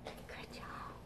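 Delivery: soft whispered good job; whispered praise. Acted. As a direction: hush it close to the kids.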